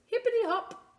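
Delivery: put-on very high, bouncy little boy voice; squeaky little boy. Little boy pretending to be a frog, high and bouncy. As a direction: hop the words like a child frog.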